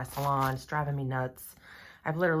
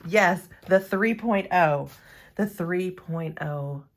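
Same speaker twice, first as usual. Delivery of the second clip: much louder, proud announcement; triumphant declaration; 2 semitones higher, 7 dB louder than usual. Real, not acted. Revealing her splurge to viewers, a loud triumphant announcement. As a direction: announce it like a prize.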